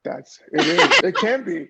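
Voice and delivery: high, loud words through laughter; laughing agreement. Real, not acted. Guest agreeing it is that simple, laughing loudly. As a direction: agree while cracking up.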